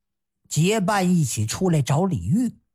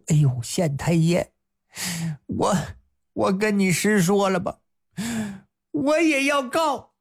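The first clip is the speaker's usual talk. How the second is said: stammering plea. Acted. Wang Xia stammering his complaint to the magistrate. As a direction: stumble over the words, then blurt it.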